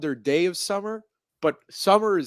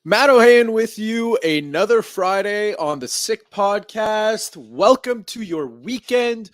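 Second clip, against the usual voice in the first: big, drawn-out announcer welcome; radio host opener; 5 semitones higher, 6 dB louder, slower than usual. Acted. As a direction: open the show like a radio host. Podcast opener to listeners.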